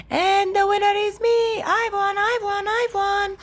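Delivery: high, chanting victory cheer; triumphant. A narrator's reading. Brother finally beats little sister, triumphant. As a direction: crow it over and over. Brother celebrates winning the race.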